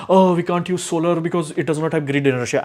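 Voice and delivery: mock objector voice with a sneer; mocking people. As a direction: parrot their excuse in a put-on voice. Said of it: "people are like".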